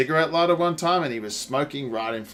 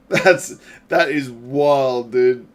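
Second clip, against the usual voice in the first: laughing loudly; 8 dB louder, much slower than usual. Real, not acted. Loud laughing disbelief.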